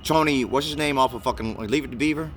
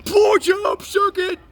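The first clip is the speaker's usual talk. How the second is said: crude shouted catcall; 4 dB louder, much slower than usual. Retold or imitated. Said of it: "the guy always like".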